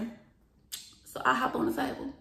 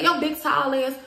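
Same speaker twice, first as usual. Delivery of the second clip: loud, higher-pitched name-calling; heated jab at an opponent; retold taunt; 5 semitones higher, 6 dB louder, slower than usual. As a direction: call them out with raised voice. Retold insult in argument.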